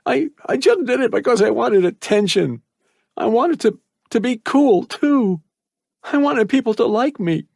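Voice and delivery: put-on boy confessing his regrets while crying; voice cracking. A narrator's reading. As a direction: confess through tears, voice breaking. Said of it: "his voice cracking".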